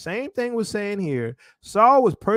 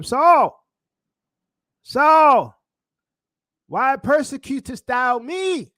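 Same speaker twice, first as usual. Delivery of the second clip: loud, higher call from heaven; 5 semitones higher, 5 dB louder, slower than usual. Acted. Preacher voices the Lord to Saul, calling out. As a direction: cry the name out, then draw out the question.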